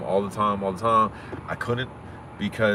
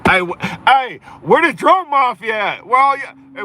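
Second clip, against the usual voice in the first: loud, high hollering for the crowd; hollering out to find the haters; calling out; 12 semitones higher, 8 dB louder than usual. Real, not acted. Streamer calling out to viewers who doubted him, loud hollering.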